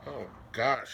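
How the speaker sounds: ordinary delivery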